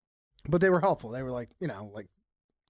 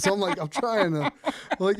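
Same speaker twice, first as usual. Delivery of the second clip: ordinary talk, nothing marked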